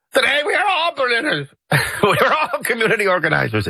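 very high-pitched, hammed-up orator; mock campaign speech. Presidential candidate addressing Germany, mocking high-pitched oratory. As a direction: parody a rally speech in a strained high voice.

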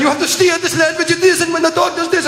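put-on high-pitched, urgent French accent; French guide. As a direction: yell instructions in a mock French accent. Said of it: "this French guy is going".

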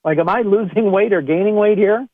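exasperated. Retold or imitated. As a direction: act stunned at the scale. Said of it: "and you're like".

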